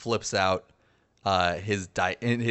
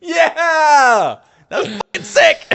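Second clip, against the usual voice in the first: much higher and louder whoop; real cheer; 13 dB louder, slower than usual. Real, not acted. Player to the game master after the session, genuinely thrilled. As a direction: celebrate at full blast.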